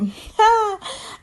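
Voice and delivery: real high-pitched laugh; high cackle. Real, not acted. Recapper delighted by Derek's jab, high cackle. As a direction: cackle at a savage comeback.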